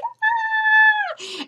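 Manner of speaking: very high delighted squeal; excited squeal. Retold or imitated. Fan drew her cat, very high excited squeal. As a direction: squeal with glee.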